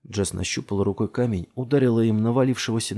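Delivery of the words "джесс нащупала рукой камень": ordinary delivery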